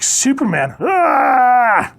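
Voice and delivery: loud, high scream of panic; freaking out. Acted. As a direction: shout the name, then scream. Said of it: "he's just freaking out".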